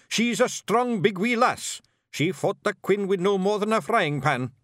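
high, brisk Scottish little warrior; Scottish pictsie leader. Acted. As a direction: bark it out like a tiny Scots chieftain. Rob defiant.

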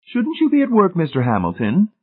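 high, prim questioning tone; prim lady voice. Acted. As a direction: ask it like a fussy matron. Pritchett questions Hamilton.

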